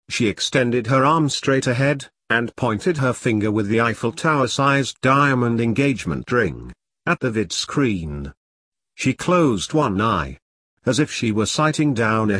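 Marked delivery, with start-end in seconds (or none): none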